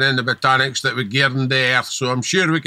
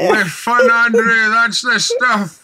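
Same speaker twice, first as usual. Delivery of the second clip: mock triumph; 7 semitones higher, 4 dB louder, slower than usual.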